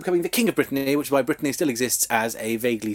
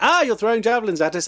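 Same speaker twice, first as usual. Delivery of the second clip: higher, indignant mock cry; Saxon soldiers' complaint; 9 semitones higher, faster than usual. Saxon soldiers protesting the javelin attacks, indignant and high. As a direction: whine it like a startled soldier.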